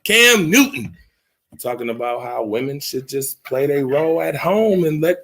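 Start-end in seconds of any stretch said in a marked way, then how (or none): 0.0-1.0 announcing hype. Real, not acted. Announcing the scandal.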